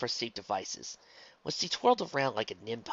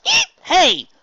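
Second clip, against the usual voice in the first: loud, high squeal of protest; startled yelp; 16 dB louder than usual. Acted. Luna being scrubbed, yelping in protest. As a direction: jump and cry out.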